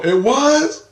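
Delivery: high astonished question; can't believe the news; surprised. Retold or imitated.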